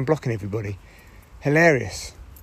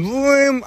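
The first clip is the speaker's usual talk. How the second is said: drawn-out revving noise, pitched up; high buzzing engine imitation; imitated clipper motor; 10 semitones higher, 3 dB louder than usual. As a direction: mimic clippers roaring into action.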